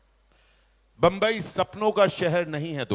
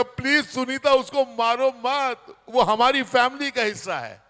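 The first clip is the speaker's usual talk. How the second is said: loud, higher, urgent plea; pleading; 6 semitones higher, 6 dB louder than usual. Retold or imitated. Guest loudly begs the owner to spare the dog. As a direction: beg her loudly not to hit it.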